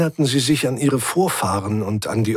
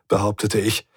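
usual speaking voice

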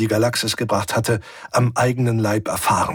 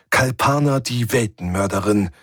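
ordinary delivery, nothing marked

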